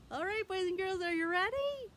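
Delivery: high, loud puppet voice; squeaky excited puppet for kids; Milo puppet voice. Pretend. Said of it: "Milo is here, and he has something he wants to tell you".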